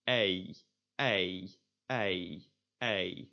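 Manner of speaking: "ei" from open e into ee; Dutch EI drill. Shown as a demonstration. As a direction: repeat "ei" in evenly spaced takes. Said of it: "That is by starting at e".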